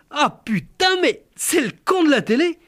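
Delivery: high, astonished rustic man; finally recognise him, amazed; amazed country husband. Retold or imitated.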